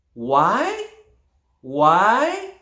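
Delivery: each WHY swept upward. Shown as a demonstration. Trainer invites hands up, WHY in an upward tone. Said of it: "An upward tone".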